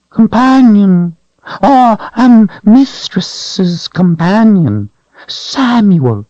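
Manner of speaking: high whining creature voice; creature whine. A narrator's reading. Creature whining to Richard about the Calthrop. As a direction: whine it out, cringing.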